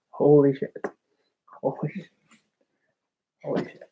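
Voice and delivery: fading to a soft breath; letting it out, shaken; trailing off into a hush; shaken and overwhelmed. Real, not acted. Overwhelmed, calming after crying.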